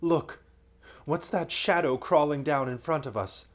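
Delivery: put-on excited boy; great excitement. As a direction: point it out thrilled. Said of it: "he said in great excitement".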